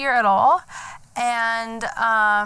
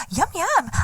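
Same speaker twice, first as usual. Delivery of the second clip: put-on TikTok girl voice; 4 semitones higher than usual. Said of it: "you can see the girls go".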